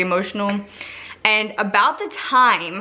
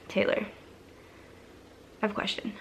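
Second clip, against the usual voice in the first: mom's soft, careful question; near-whisper, as if broaching something delicate; hushed question; 14 dB quieter, faster than usual. Retold or imitated. Mom asks a delicate question.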